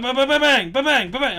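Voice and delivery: high loud playful gunfire sounds; gunshot noises. Pretend. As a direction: shout bang like a kid's toy gun. Mimicking gunfire in game.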